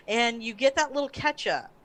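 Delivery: normal talking voice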